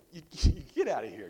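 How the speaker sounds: laughing. Real, not acted. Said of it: "laughing at own joke".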